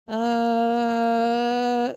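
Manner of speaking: long, drawn-out level filler; acted hesitation. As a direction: stall on one held note. Own reluctant reply to friend, acted.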